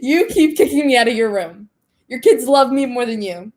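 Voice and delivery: loud, laughing teasing retort; playful sass. Retold or imitated. Student teasing her math teacher, loud laughing sass. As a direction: tease him with mock indignation.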